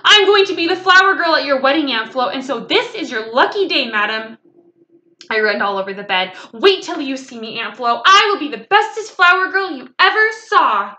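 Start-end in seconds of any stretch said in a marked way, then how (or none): 0.0-4.4 loud, high, rushed announcement; gleeful little-girl outburst, settling slightly; blurting out a surprise. A narrator's reading. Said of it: "the surprise popped right out of my mouth". 6.5-10.9 put-on loud, high childish boasting; excited little girl building to a shout. A narrator's reading. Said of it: "very excited".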